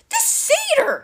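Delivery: very high-pitched yell. A narrator's reading. Captive Clarice exposes Grover, high yell. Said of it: "Clarice yelled".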